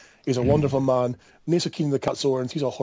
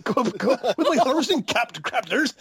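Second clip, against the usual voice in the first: higher-pitched, laughing mock regional accent; hammed-up imitation of a friend's accent; Baglin's accent; 8 semitones higher than usual. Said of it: "you've got Baglin with his".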